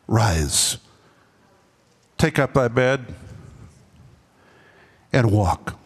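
low, slow solemn command; commanding Jesus. Acted. Preacher voicing Jesus commanding the man, low and solemn. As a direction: deliver it as a weighty command.

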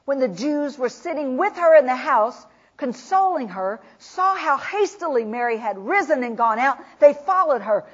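normal talking voice

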